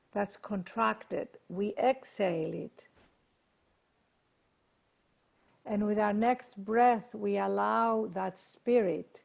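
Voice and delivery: unhurried meditation voice with long pauses; guided meditation. Acted. Teacher leading a group to breathe and observe, guided meditation. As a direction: guide them into stillness, letting phrase ends fade.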